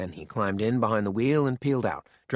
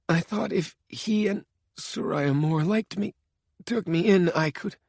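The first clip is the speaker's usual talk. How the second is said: pathetic plea; 8 semitones higher than usual. Acted. Dying man pleads feebly with his killer, pathetic. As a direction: beg weakly, voice thinning out. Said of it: "Richards's voice sounded pathetic".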